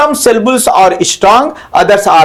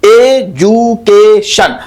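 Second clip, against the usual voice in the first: Education very slowly in four beats, E, JU, KE, SHUN; split into syllables; much slower than usual. Shown as a demonstration. Teacher counting syllables for students, very slowly split up. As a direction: stretch and separate all four syllables of education. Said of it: "education में चार syllable है".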